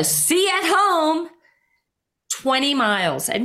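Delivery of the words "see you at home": high, drawn-out call out the window; mock yell, pitched way up and stretched; retold yell. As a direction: call it out as if from a departing car. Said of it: "yells out the window".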